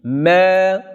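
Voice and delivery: "Ma" held long; lengthened vowel. Shown as a demonstration. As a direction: stretch the "a" in "Ma". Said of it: "met een verlenging op de alif".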